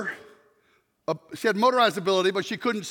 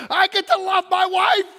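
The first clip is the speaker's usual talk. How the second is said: loud, slow, arms-up joy; quoting the mentor's joyful cry; joyful exclaim; 8 semitones higher, 6 dB louder than usual. Retold or imitated. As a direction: proclaim it like a gift. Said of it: "he said it just like that".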